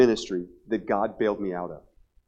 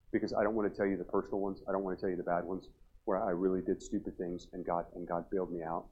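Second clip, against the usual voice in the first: ordinary talk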